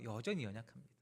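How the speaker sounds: ordinary delivery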